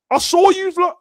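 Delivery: high, loud incredulous call-out; mock call-out. To fans in chat, high loud mock call-out. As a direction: call him out, voice way up.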